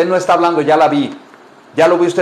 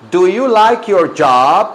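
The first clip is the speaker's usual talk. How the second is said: slow, raised teacher model; slow modelling; 3 semitones higher, 4 dB louder, slower than usual. Shown as a demonstration. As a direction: stretch each word out for the class. Teacher models phrase for class.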